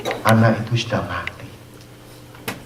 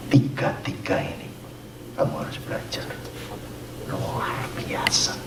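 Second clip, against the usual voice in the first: much softer, whispered aside; hushed whisper; 9 dB quieter than usual. Real, not acted. Preacher urging the church to learn from the three, whispered. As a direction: lean in and whisper it.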